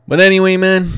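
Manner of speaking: high, loud burst. Real, not acted. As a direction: reset the energy with a shout. Loud transition after ranting.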